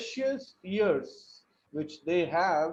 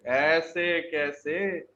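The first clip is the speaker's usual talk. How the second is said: slow drawn take; 2 semitones lower, much slower than usual. Shown as a demonstration. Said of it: "stressing कर रहे हैं".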